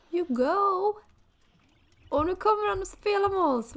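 high and loud, bubbling over; excited cheering. Real, not acted. Player cheering her Sims, high and loud. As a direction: cheer them on like a fan.

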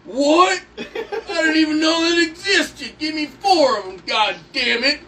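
loud, high, over-the-top amazement; hammed-up excited customer; thrilled mock shout. Customer discovering a new product, excited shouting.

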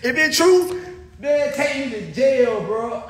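high, slow, drawn-out mock plea; a laughing, hammed-up surrender. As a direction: stretch it out high, laughing at the idea.

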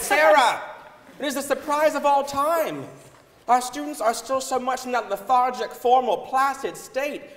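thrilled telling of the surprise; excited retelling. Retold or imitated. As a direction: share the astonishing news with glee. Retold gleeful outburst to Sarah.

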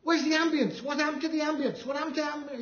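high, frantic retold demand, imitating the tuner; flipping out. Piano tuner demanding the missing ambience, high frantic imitation. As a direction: mimic a panicked piano tuner losing his sound. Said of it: "The guy flips out".

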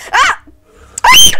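short, high-pitched shrieks; startled scream. Real, not acted. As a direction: scream out loud in fright. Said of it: "did you hear me scream".

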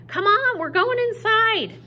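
high, loud call of his name; hollering. Acted. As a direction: holler across the rainy garden. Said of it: "I hollered at him over the rain".

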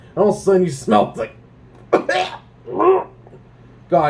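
usual speaking voice

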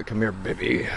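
hammed-up, teasing come-on; suggestive come-on. Teasing a friend with a pet name, mock suggestive. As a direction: coo it as a joke come-on. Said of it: "don't say it like that".

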